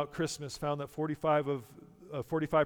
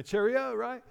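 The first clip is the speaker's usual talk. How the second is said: high, hammed-up English accent; exaggerated English accent, pitched way up; 9 semitones higher, 4 dB louder than usual. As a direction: chirp it in a mock English accent. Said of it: "There's my English accent coming in".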